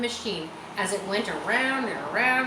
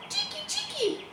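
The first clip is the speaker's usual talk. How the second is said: very high twittered cheeky, cheeky; high bird chirp; swallow call. A narrator's reading. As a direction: squeak it out like a swallow. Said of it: "they say".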